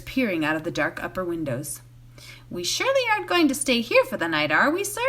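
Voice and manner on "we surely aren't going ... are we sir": higher, alarmed exclamation; exclaiming. A narrator's reading. Sam balking at the big inn, anxious exclaim. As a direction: blurt it out in dismay. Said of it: "he exclaimed".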